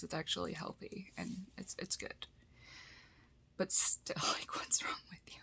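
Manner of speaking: conspiratorial whisper, laughing at the end. Real, not acted. Whispering a teasing aside to viewers who disagree.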